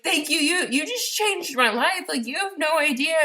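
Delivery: high, choked-up tearful gratitude; overwhelmed, teary thank-you. Retold or imitated. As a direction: thank her through happy tears. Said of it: "and I'm crying".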